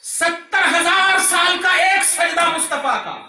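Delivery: high, ringing climax of a sermon; impassioned proclamation. Real, not acted. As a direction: proclaim it at full force. (जोश भरा ऊँचा एलान)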